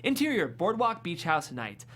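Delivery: normal talking voice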